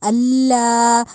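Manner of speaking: اللہ slow with a stressed double لل; doubled lam. Shown as a demonstration. Teacher recites اللہ slowly with shadda. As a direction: press the لل of اللہ hard. Models verse word.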